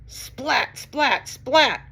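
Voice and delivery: high splats building in volume; lightning splats. Acted. Reader imitating lightning for children, high splats. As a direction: crack out each splat like lightning. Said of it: "He makes lightning".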